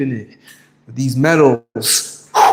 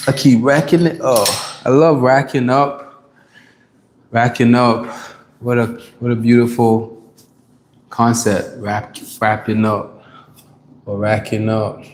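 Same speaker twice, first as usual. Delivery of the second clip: ordinary talk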